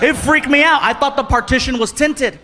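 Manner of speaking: ordinary talk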